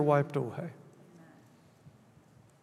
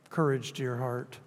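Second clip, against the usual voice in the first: tender comfort; 5 semitones lower, 8 dB quieter than usual. Real, not acted. Pastor comforts congregation.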